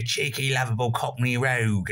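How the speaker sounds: badly faked Cockney rogue; terrible Cockney accent. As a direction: put on a cheeky, clumsy Cockney. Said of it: "that was a terrible accent".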